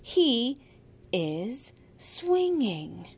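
"He" loud, "is swinging" very slow; slow modeling. Shown as a demonstration. Teacher modeling the correct pronoun for a child, slow. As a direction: hit He, then draw out the rest. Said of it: "We have to start with he".

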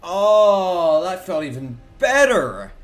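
excited. Real, not acted. Cheering a good game hit.

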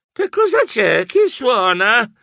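high, drowsy, slow wake-up call; just woken, groggy and puzzled; sleepy confusion. A narrator's reading. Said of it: "Corsi assonnato". (Geronimo appena svegliato, assonnato e confuso)

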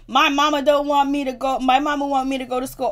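put-on crybaby protest; mocking imitation. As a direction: imitate a complaining victim. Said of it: "the way he just imitated".

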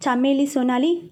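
ordinary delivery

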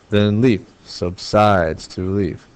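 slow, stressing "leaf", "sides" and final "leaf"; iambic scansion. Shown as a demonstration. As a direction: read "Then leaf subsides to leaf" in three slow weak-strong beats. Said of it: "I'm looking for three iambs per measure".

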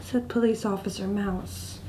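plain reading voice

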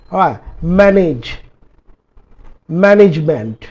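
normal talking voice

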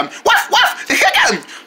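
barking like a dog. Pretend. Playful dog bark.

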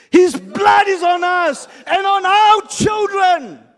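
loud, high mob chant; angry crowd shout. Pretend. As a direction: declare it like a raging crowd. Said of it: "all the crowd answered".

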